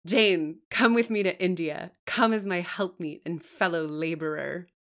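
loud, insistent summons; relentless voice. A narrator's reading. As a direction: command her to join the mission, unyielding. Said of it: "the deep, relentless voice".

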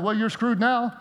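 chuckling through a teasing God voice; laughing mock God. Pastor laughing as God teases a praying believer. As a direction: laugh as you play God shrugging it off. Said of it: "imagine God up in heaven going".

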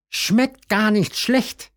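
high, slow savouring rabbit; high slow pleased bunny; tasting approvingly. A narrator's reading. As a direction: draw it out slowly, pitched high. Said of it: "sagt Hasen Tim".

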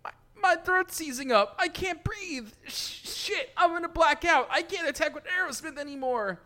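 high, strained gasping lines; choking panic. Acted. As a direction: fight for air as you speak. Character choking, can't breathe.

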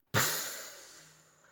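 wolf blowing out hard air; long puff of breath. Acted. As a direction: blow a long airy puff. (long souffle du loup)

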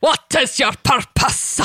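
percussive villain voice with an extra syllable on "purpose"; theatrical, over-enunciated villain question; Davy Jones impression. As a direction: pop each word, adding a tail to "purpose". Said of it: "Putting syllables in words that don't exist".

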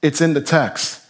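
normal talking voice

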